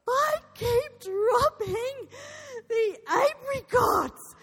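high, loud, drawn-out wail; mock child sobbing. Son to mother over dropped apricots, mock bawling. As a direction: bawl it slowly like a distraught little boy. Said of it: "he is sobbing it".

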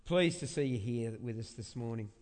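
ordinary talk

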